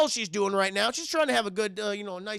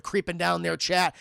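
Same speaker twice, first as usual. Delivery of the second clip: ordinary talk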